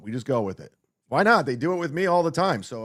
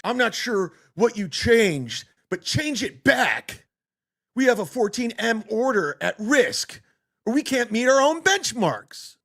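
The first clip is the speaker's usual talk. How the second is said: mock angry yelling; 7 semitones higher than usual. Host mockingly rereads a yelled text message, mock angry yelling. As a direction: read it as an exaggerated high-pitched tirade.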